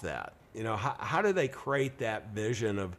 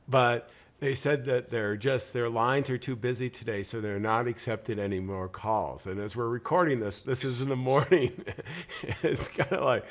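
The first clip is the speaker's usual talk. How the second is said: usual speaking voice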